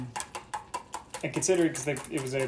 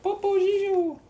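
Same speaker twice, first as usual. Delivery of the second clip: very high, squeaky cartoon voice; movie quote impression; 4 dB louder, slower than usual. Quoting a Christmas movie for the listeners, squeaky high voice. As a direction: squeal it like a puppet character. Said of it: "what movie is that from".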